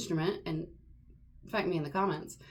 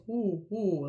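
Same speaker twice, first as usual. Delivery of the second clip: open ooh. Shown as a demonstration. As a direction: keep the big space inside on ooh. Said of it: "We wanna create that space".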